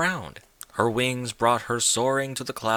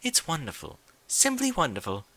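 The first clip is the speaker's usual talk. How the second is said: delighted Rarity; 5 semitones higher than usual. Acted. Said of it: "hummed and wriggled in delight".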